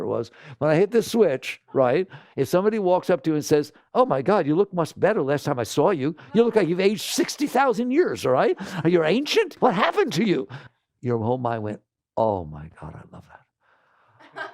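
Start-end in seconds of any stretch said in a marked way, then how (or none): 3.9-10.5 high, loud mock gasp at how old you look; shocked insult. Someone insults you to your face. 12.2-14.5 low, soft laughing whisper of delight; delighted mind. Pretend. The mind reacting to an insult.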